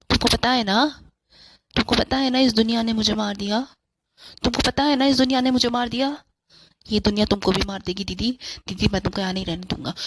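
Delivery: a dead brother luring his sister away, pleading then threatening; eerie ghost brother. Acted.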